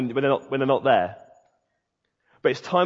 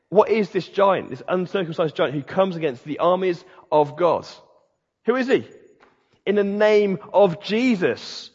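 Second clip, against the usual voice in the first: higher-pitched, emphatic pulpit declaration; rally them against the enemy; rousing preaching; 5 semitones higher than usual. Real, not acted. Preacher rallies the church against the giant, rousing preaching.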